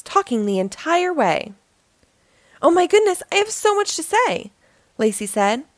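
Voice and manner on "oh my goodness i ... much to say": put-on high-pitched, eager storybook child; excited gushing. A narrator's reading. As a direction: burst out, bubbling to talk. Excited child bursts into talk.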